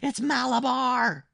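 put-on very high, drawn-out boy's scream; frenzied high cry, stretched out; powerful strange scream. A narrator's reading. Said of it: "he screamed in a powerful, strange voice".

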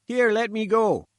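high, loud sputtering protest. Acted. Reporter held by the gatekeeper, sputtering high and loud. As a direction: splutter it out, struggling to get free. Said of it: "he sputtered".

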